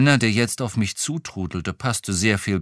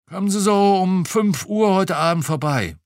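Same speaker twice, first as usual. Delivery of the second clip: put-on lightly raised, chatty old cop; a hint of a gossiping retired policeman; 10 semitones higher, 7 dB louder than usual. A narrator's reading. Retired cop chatting to the detective over lunch, lightly voiced.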